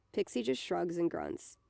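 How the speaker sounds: usual speaking voice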